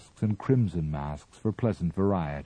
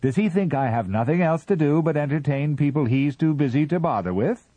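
high and loud, indignant; peevish Martian voice; 9 semitones higher, 6 dB louder than usual. A narrator's reading. Mister Ah Ah scolds the visitors, high and indignant. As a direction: whine it out high and put-upon.

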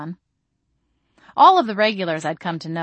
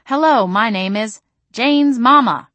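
higher, louder, slow mama voice reading the tag; Mama's name tag; 5 semitones higher, 7 dB louder than usual. A narrator's reading. Narrator reading mother's name tag in her voice. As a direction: read it out like a proud mother.

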